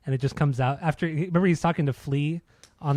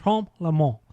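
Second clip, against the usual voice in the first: exaggerated French pronunciation; French accent. Shown as a demonstration. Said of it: "we're great with the French accent".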